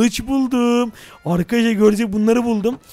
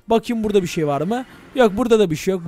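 ordinary talk, nothing marked

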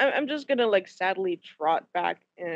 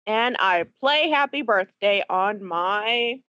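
ordinary talk

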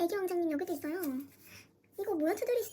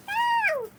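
excited squeal; 5 dB louder, much slower than usual. Real, not acted. Squealing over a longed-for photocard.

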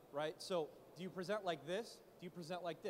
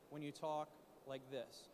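slow, soft, low droning; dull monotone; 4 semitones lower, 6 dB quieter than usual. Shown as a demonstration. Coach showing why audiences can't get engaged, dull monotone. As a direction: drag it out quietly with no animation. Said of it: "Don't talk like that".

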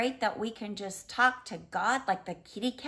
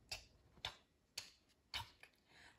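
clock ticking whispered; 23 dB quieter than usual. Acted.

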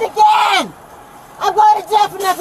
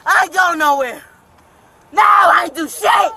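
normal talking voice

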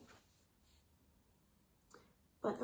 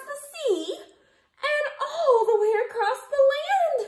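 high, loud, excited telling; breathless excited narration; dramatic climax; 12 semitones higher, 9 dB louder than usual. A narrator's reading. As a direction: build the big moment.

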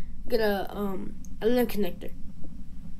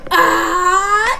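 long "ah" climbing in pitch; exasperated yell; 4 dB louder than usual. Acted. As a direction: draw out a rising yell. Yell in acted argument.

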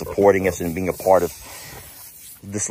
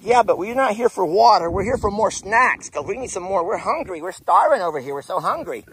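higher-pitched begging pig voice; hungry piggies voice; 9 semitones higher than usual. Retold or imitated. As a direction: whine for snacks like the hungry pigs. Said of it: "They're like".

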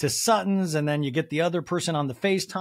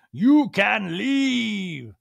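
high, very slow, louder put-on; mock dismissal; 6 semitones higher, 6 dB louder, much slower than usual. Host imagining a haughty retort, slow and high. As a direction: draw it out as a haughty brush-off.

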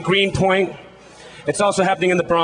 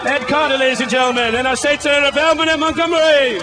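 announcing to the crowd; 6 semitones higher than usual. Real, not acted. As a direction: build the name up for the crowd. MC announcing next speaker to crowd.

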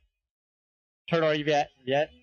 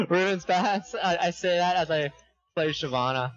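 ordinary talk

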